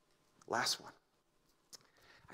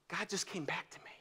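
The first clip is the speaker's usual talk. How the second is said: awed whisper; whispered God came back; hushed; 4 semitones higher, 5 dB quieter than usual. Retold or imitated. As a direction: breathe it like a secret revelation. Quiet awe in sermon story.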